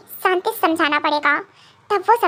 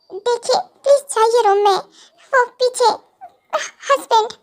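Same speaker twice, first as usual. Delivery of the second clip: put-on higher, halting girl's voice; hesitant plea, pitched up; nervous warning; 4 semitones higher than usual. Kookie warns of husband behind him, nervous plea.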